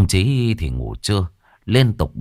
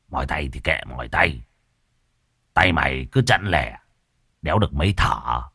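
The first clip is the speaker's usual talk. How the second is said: put-on much lower, softer scoffing old man; sneering reply; 7 semitones lower, 3 dB quieter than usual. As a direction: brush him off with a sneer. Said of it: "cười nhếch mép". (giọng trầm, nhỏ, khinh khỉnh)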